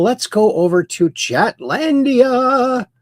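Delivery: high, drawn-out showy announcement; playful announcing. Pretend. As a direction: send them off to a make-believe land, stretching the name. Host announces move to chat.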